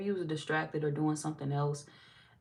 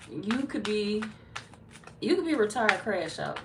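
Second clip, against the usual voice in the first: normal talking voice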